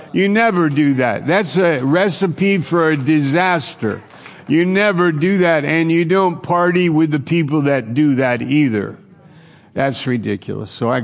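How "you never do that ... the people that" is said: fervent warning. Real, not acted. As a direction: raise the voice and warn them hard. Preacher warning the students.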